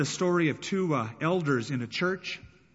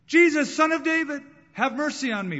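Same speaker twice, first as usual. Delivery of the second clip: desperate high shout, calling for help; a blind beggar crying out to Jesus, loud and high; 11 semitones higher, 6 dB louder than usual. Retold or imitated. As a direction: cry it out to be heard over a crowd. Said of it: "But he cried out all the more".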